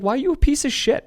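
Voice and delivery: high-pitched, incredulous confrontation; retold indignant challenge. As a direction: act out confronting someone, pitch jumping up.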